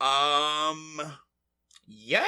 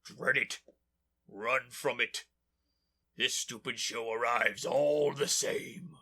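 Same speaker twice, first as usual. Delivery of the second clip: low menacing villain; 8 semitones lower, 5 dB quieter than usual. Acted. Podcast intro villain bit.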